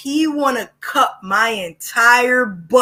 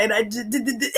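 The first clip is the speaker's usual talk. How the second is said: mock stammer, softer; stuttering; 7 dB quieter, faster than usual. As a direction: stammer the d sound.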